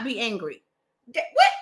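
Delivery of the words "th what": high, loud baffled what; incredulous. Real, not acted. Vlogger baffled by the book's contradiction, loud and high. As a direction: throw it back in disbelief.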